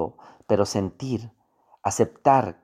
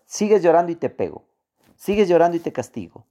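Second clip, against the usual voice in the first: threatening parent; 6 semitones higher, much faster than usual. Retold or imitated. Parent scolding a crying child, fast and stern. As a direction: rattle off a stern parent's warning. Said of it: "O decías".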